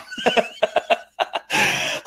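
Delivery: high, voiceless laugh. Real, not acted. After retelling a pre-diabetes diagnosis, high laugh. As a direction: laugh off the bad news.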